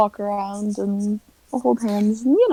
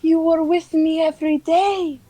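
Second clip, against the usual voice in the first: put-on higher, pleading Slavic-accented lover; 5 semitones higher than usual. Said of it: "Irish or Slavic?".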